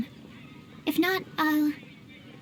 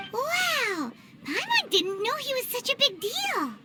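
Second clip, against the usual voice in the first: impressed wow; 7 semitones higher, 4 dB quieter, slower than usual. Acted. Paimon impressed by Albedo.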